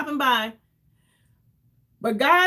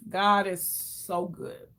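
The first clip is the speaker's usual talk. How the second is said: usual speaking voice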